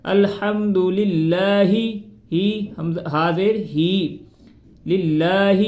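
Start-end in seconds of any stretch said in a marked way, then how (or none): 0.1-1.6 very slow, tuneful recitation; drawn-out recitation; melodic recitation. Shown as a demonstration. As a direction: chant the phrase out long. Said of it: "demo after the error".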